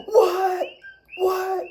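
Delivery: high shocked double what, shouted; shocked scream. Retold or imitated. Peleg on his bike spots her and screams: high, loud disbelief. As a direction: yell it in disbelief at a friend you didn't expect. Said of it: "and he screams".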